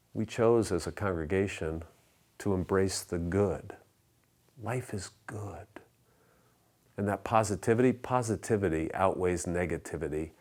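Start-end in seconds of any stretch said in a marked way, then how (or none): 4.6-5.7 soft, near-whispered reminder; hushed affirmation. Real, not acted. Pastor restating the congregation's motto, hushed. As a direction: let the motto drop almost to a whisper.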